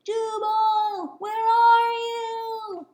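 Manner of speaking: high, slow, singsong far-off call; distant call. Acted. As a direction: call from far across the water. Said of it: "A faint voice called in the distance".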